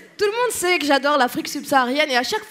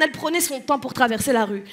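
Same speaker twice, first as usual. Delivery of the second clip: normal talking voice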